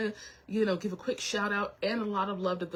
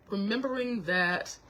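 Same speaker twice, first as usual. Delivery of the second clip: usual speaking voice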